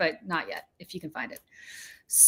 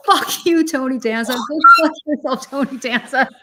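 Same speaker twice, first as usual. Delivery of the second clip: loud furious yelling, higher; chasing a celebrity down the street, shouting furiously; crazy yelling; 4 semitones higher, 9 dB louder than usual. Retold or imitated. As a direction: yell it down the street at him. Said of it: "I go crazy".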